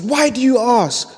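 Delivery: woman on train asks back; woman's voice. Retold or imitated. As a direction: voice the surprised woman.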